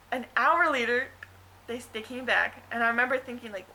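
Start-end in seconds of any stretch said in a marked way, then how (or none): none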